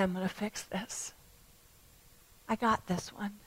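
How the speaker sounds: softly voiced comforting Jesus; hushed, assured comfort to a mourner; quiet reassurance. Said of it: "I think he was saying".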